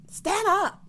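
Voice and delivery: high, loud command; emphatic shout. Pretend. Recapper urging Aaron to man up, emphatic shout. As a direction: shout it high like a rallying order.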